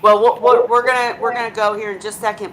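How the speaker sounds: ordinary talk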